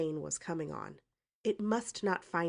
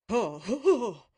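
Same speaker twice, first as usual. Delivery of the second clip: mocking laugh; 6 semitones higher, 9 dB louder, slower than usual. A narrator's reading. Uncle's mocking guffaw.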